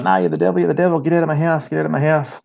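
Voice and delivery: high, mock-frantic woman's voice, rushed; mock frantic yell. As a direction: imitate her wild shrieking accusation. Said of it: "She's like".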